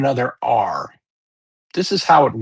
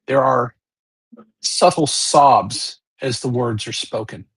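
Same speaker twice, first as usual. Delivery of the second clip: ordinary delivery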